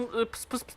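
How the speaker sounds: soft unvoiced pıs pıs hiss with a lead-in; creeper hiss. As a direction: fake a creeper fuse hiss. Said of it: "Ben de sizin gibi osuruyorum". (creeper taklidi, sessiz tıslama)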